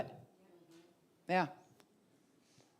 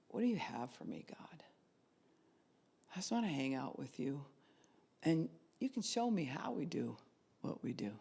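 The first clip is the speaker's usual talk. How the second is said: whispered, intimate prayer; hushed relaxed prayer; 6 dB quieter than usual. Shown as a demonstration. Preacher demonstrates a relaxed prayer to God. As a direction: speak to God softly, relaxed. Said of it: "Just, like".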